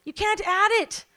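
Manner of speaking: preacher raising the voice; emphatic preaching shout. Real, not acted. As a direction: punch it out to the crowd.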